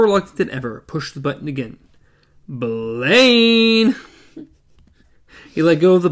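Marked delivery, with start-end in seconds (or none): 2.5-4.0 calling out. Acted. Calling into intercom.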